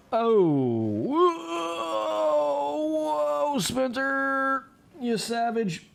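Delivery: high, drawn-out real exclamation of surprise; startled whoa. Real, not acted. Gamer reacting to Spencer's savage move, in startled amazement.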